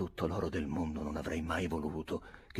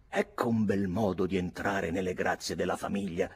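plain reading voice

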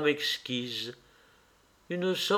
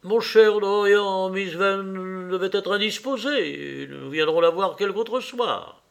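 lightly voiced Lord Henry, consoling; 6 semitones higher, 4 dB louder than usual. A narrator's reading. Lord Henry calming Dorian after the play, lightly voiced suave tone.

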